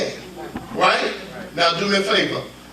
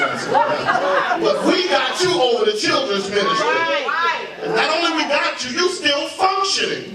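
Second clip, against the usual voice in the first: preaching shout; 8 semitones higher than usual. Acted. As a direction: lift it into a shout. Preaching climb.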